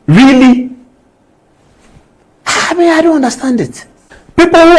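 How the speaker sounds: outraged host rebuking a journalist; indignant outrage. Real, not acted. Host berates journalist on air.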